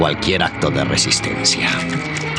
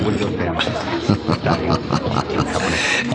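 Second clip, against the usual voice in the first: quiet evil chuckle; 3 dB quieter than usual. Acted. Villain's satisfied laugh.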